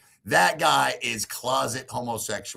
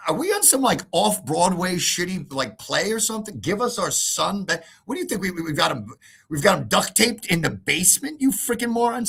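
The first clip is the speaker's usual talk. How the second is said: higher incredulous mocking; sarcastic retort; 7 semitones higher than usual. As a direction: ridicule him as a moron.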